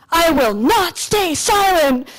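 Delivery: slow, loud and firm, every word landing; a rallying cry, slowed right down; defiant declaration. Retold or imitated. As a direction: announce it like a vow to the whole hall. Quoting her defiant stage line.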